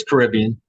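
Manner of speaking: "Caribbean" as kuh-RIB-ee-un; local pronunciation. Shown as a demonstration. Man from down this way settling it, "Caribbean" stressed on RIB. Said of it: "I'm from down this way".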